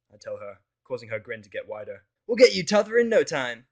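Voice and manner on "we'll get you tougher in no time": much higher and louder, bursting with energy; declaring. Acted. Rainbow promising to toughen up the narrator, eager loud declaration. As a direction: announce it like a gung-ho coach. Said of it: "she declares".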